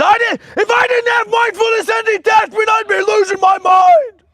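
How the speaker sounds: high, loud frantic scream. Acted. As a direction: scream like you're losing it. Screams about losing his mind.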